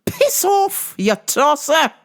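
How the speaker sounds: high mock-British telling-off; squeaky, hammed-up British insult; indignant old equipment. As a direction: play offended gear with an English accent. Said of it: "my old equipment's like".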